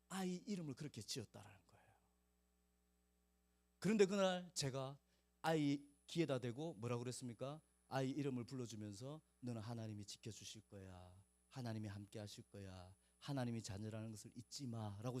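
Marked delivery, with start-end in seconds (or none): 0.1-1.9 whisper. Real, not acted. Telling the mother's earnest plea. 9.4-15.2 soft tender blessing. Retold or imitated. Repeating the blessing into the ear.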